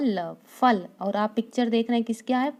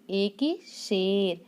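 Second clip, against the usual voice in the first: ए की, शेर said very slowly; slow answer; 2 semitones lower, 3 dB quieter, much slower than usual. Shown as a demonstration. Teacher gives the answer to children, very slowly. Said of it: "किसकी मात्रा लगेगी".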